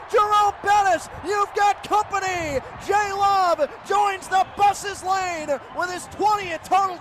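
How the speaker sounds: high, loud shouting with a stretched name; excited pick six call. Real, not acted. Announcer shouting an interception return touchdown. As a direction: let it rip on the interception return.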